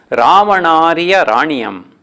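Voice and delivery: slow verse reading; poem recitation. Shown as a demonstration. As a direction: chant the line slowly. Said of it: "ಹದಿನಾರನೇ ಪದ್ಯ". (ನಿಧಾನ ಪದ್ಯ ವಾಚನ)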